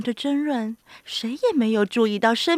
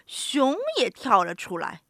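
ordinary delivery, nothing marked

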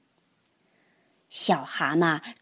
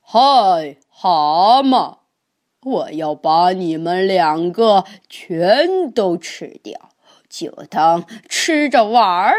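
huge booming monster roar, much louder; 2 semitones lower, 6 dB louder than usual. Acted. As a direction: bellow like a giant beast toying with prey. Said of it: "怪兽咆哮道". (巨大怪兽大声咆哮)

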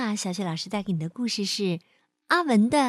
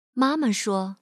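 ordinary delivery, nothing marked

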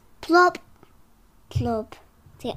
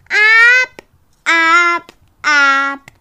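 excited; 5 semitones higher, 13 dB louder, much slower than usual. Acted. Climbing effort voiced.

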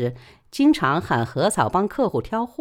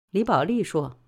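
plain reading voice

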